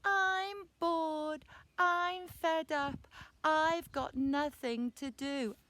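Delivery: put-on high, drawn-out child's whine; whiny, bored little boy; really whiny. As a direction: whinge loudly to mum, dragging every word. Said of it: "in a really whiny voice".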